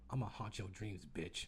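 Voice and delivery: low, soft mock threat; mock-menace her under your breath, low; menacing mutter. Passenger turning round to spook the woman, low menace. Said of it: "look at her and I'll be like".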